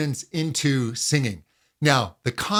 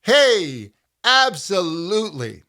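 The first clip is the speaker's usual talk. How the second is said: higher, louder eager yes; energetic agreement; 4 semitones higher, 3 dB louder than usual. Shown as a demonstration. Teacher modelling light energetic agreement. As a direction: say it like you mean it. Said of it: "but it's energetic".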